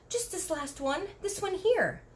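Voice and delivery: a hint of eager Sophie; lightly voiced girl. A narrator's reading. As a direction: point and ask eagerly. Voicing Sophie.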